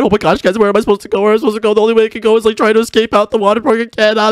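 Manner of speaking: normal talking voice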